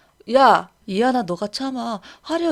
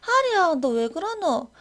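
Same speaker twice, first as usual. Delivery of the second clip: normal talking voice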